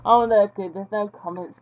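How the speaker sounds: ordinary delivery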